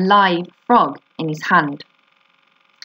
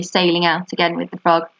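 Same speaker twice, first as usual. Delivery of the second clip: plain reading voice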